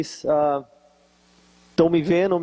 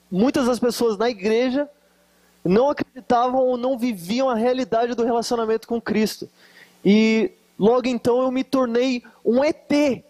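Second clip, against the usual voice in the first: normal talking voice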